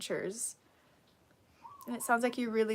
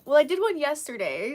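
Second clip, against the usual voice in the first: ordinary talk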